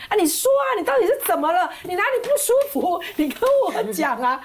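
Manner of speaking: high, pleading, worried questions; husband frantically begging her to talk, worried pleading; anxious husband. Retold or imitated. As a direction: panic over a crying wife. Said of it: "我老公好紧张说".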